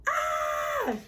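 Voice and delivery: sudden high startled scream. A narrator's reading. Girl sees stripes in the mirror, screaming in shock. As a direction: shriek at the mirror. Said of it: "Then she screamed".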